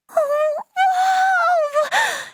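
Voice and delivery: high, drawn-out wail of wonder; awestruck. Acted. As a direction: gasp out your amazement, stretched and high. Awed on seeing the consortium.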